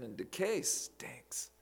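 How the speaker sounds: slow whisper of "and decay stinks". Acted. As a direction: hush it like a grim secret. Disgust at decay, hushed.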